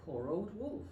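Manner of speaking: ordinary delivery, nothing marked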